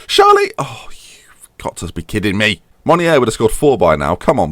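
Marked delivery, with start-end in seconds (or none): 0.1-0.5 much higher, loud yell of the name; sudden high cry at the screen; frustrated shout. Real, not acted. Chance missed in the match. 0.6-2.6 slow exasperated whisper; soft slow whisper after the miss; whispered disbelief. Real, not acted. Disbelief after the missed chance.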